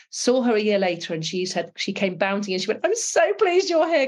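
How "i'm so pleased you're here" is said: high, bright rush of delight, slowed and loud; excited girl. Retold or imitated. Cadet greeting her old pilot, excitedly high and loud. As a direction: gush like a thrilled teenager.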